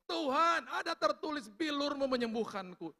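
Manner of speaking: high, loud cry to God; retold shouted declaration. Sick man crying scripture to God, shouting. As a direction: proclaim scripture at the top of the voice. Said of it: "Saya berteriak".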